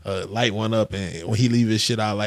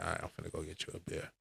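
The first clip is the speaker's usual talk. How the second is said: casual offer; 6 semitones lower, 20 dB quieter, much faster than usual. Retold or imitated. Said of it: "He like".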